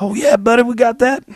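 high, mock-folksy buddy voice; imitating Corey. Answering a fan's song request, in a breezy imitation of Corey. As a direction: pitch way up and play it easygoing. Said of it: "And he'd be like".

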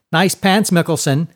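high, loud schoolboy jeer; leering taunt. A narrator's reading. Gap-toothed boy mocks Seinen's pants, leering taunt. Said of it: "leering at Seinen's too short pants".

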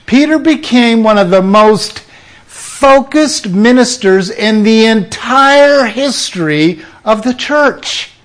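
ordinary delivery

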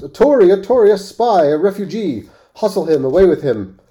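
loud, high angry shouts of the crowd; crowd shouting. A narrator's reading. Bystanders shout at the stranger, loud and angry. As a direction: hurl the accusation at him. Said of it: "a general shout burst from the bystanders".

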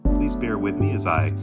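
plain reading voice

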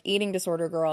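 ordinary talk, nothing marked

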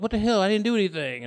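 lightly panicked retold outburst; mock scared. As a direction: act out being scared and innocent. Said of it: "I was, like, scared".

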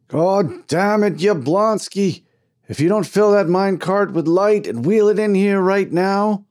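high, loud, exasperated bellow; crying out angrily. A narrator's reading. Foreman ordering the minecart filled with light, furious shout. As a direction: holler an ultimatum at full tilt. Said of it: "cried the foreman".

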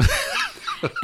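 high bursting laugh; burst of laughter. Real, not acted. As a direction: crack up laughing. Laughs after dad's punchline.

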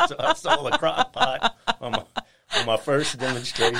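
laughing. Real, not acted. Telling the host about her first sale, laughing. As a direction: finish the punchline while still laughing.

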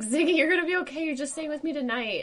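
put-on high, fast soothing baby talk to the dog; reassuring dog. Calming a crying Great Dane, high fast baby talk. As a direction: coo at the panicking dog quickly. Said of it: "And I'm like".

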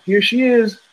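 nurse announcing. Retold or imitated. Nurse presenting the newborn to the parents, proud and high. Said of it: "you're supposed to hear".

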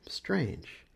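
plain reading voice

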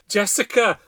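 high, loud calling of a name; desperate cry. A narrator's reading. Mother hears her child screaming inside, desperate loud cry. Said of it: "I cried".

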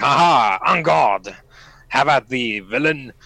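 hammy swashbuckler challenge; mock-heroic duel cry; stagey fake archaic declaiming. Pulls sword, hams up a duel.

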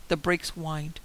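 ordinary delivery, nothing marked

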